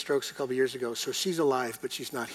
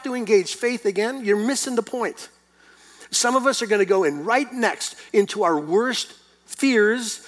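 louder, rising preacher's cry; full-force preaching; 5 semitones higher, 6 dB louder than usual. Acted. Pastor urging the congregation, loud full-force preaching. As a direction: press the point home to the church.

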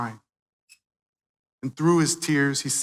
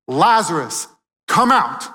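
Lazarus, come out shouted higher and louder; loud command; 3 semitones higher, 8 dB louder than usual. Retold or imitated. Preacher voicing Jesus calling Lazarus, ringing shout. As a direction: call him out of the tomb at full voice. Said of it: "he says three words that should make us tremble".

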